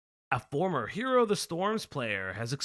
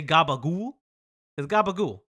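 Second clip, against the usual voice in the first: put-on Italian accent; 5 dB louder than usual. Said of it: "Italian accent".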